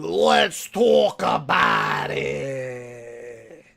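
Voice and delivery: slow, drawn-out, loud Midwest accent; hammed-up Midwest accent, very slow; exaggerated Midwest accent. Shown as a demonstration. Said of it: "that's me over-exaggerating my Midwest accent".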